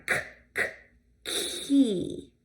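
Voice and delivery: "key" with k from the throat; throaty K. Shown as a demonstration. Teacher contrasting tappy and throaty sounds. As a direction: start "key" at the back of the throat. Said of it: "That's a throaty sound".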